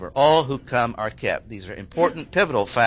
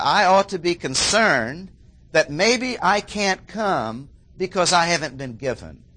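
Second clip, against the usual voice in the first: ordinary talk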